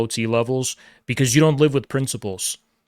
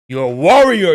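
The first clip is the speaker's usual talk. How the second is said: louder, resonant warrior call; booming chest voice; 3 semitones higher, 4 dB louder than usual. Shown as a demonstration. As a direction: announce it like a warrior. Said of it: "Your voice booms".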